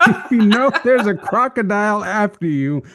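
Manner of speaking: high, loud, laughing delivery; amused. Real, not acted. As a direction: laugh your way through the joke. Laughs at his own joke.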